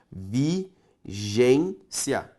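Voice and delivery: Vigência drawn out very slowly, syllable by syllable; teacher separating syllables aloud; syllables pulled apart. Shown as a demonstration. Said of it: "Separe comigo".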